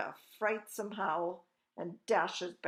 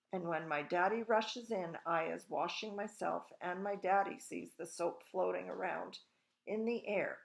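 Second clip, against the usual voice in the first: ordinary delivery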